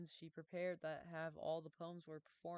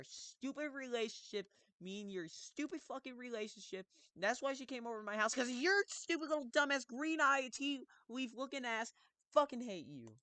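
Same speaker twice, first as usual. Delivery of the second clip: fast, high, loud angry rant; 9 semitones higher, 7 dB louder than usual. Real, not acted. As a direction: rant at her in fury.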